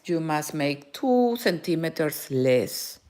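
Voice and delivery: usual speaking voice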